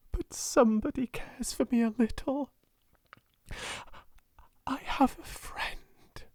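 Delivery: high voice sinking into a breathy whisper; tearful. A narrator's reading. Girl realising she has a friend, tearful. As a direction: say it overcome, nearly voiceless by the end. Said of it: "burst into tears".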